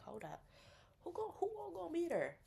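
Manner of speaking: high, probing question; suspicious friend. Retold or imitated. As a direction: demand the guest list up high. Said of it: "They like".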